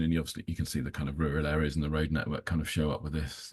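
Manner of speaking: normal talking voice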